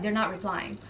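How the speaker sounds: soft, low relayed reply; downplayed report. Friend reporting back on the guests, soft and low. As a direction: drop it low and quiet.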